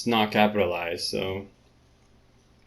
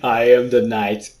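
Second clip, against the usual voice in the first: Batman voice; 6 dB louder than usual. Pretend. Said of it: "you can call me Batman".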